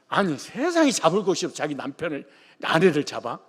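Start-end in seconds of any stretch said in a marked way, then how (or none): none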